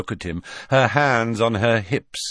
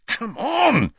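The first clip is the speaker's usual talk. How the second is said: put-on much higher, pushy boyish urge; urging Ron; 13 semitones higher, 3 dB louder than usual. Ron pressing Hermione to hide, much higher and impatient. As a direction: coax her along impatiently.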